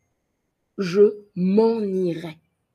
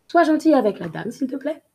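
quick, higher rushed request; fast take; 6 semitones higher, 3 dB louder, much faster than usual. Shown as a demonstration. Teacher speeding up the example for practice. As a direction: rattle it off higher and faster.

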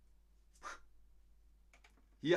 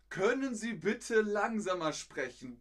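polite request; 9 semitones higher, 6 dB louder than usual. Shown as a demonstration. Polite long request, played up.

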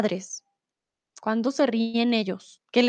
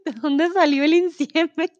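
laughing question; 5 semitones higher, 3 dB louder than usual. Real, not acted. Amused question between laughs.

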